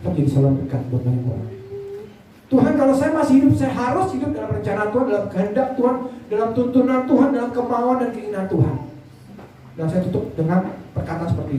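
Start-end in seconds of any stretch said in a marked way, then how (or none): 2.5-8.8 higher-pitched, earnest plea to God; fervent prayer. Retold or imitated. Preacher vowing his life to God, fervent prayer. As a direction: pray it out with raised pitch. Prays aloud within the sermon.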